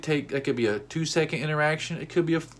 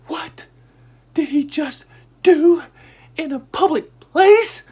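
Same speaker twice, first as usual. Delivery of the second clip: high, loud, slow disbelief; family stunned at his outburst, high and slow; shocked family; 10 dB louder, slower than usual. Retold or imitated. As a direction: gasp out the question in shock. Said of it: "My family was like".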